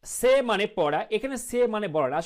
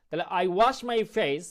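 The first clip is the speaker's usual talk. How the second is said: normal talking voice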